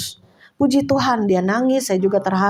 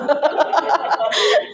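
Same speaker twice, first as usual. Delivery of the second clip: amused laugh at oneself; 3 semitones higher than usual. Real, not acted. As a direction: laugh off the story. Laughs at having own house. (tertawa geli)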